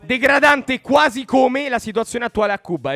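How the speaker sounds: loud mock outrage; indignant shout.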